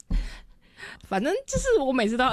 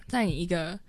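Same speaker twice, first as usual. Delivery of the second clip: normal talking voice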